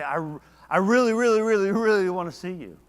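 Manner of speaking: loud, high, really pushing each really; emphatic longing. Shown as a demonstration. Preacher showing how we say we miss someone, loudly emphatic. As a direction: lean hard into every really, almost calling out. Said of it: "We say".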